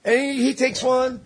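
ordinary delivery

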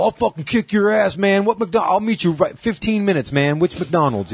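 imitating a riled-up man, high-pitched; mock furious threat, pitched well up; retold pissed. Husband challenging the prank caller to a fight, mock pissed. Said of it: "That guy got so pissed".

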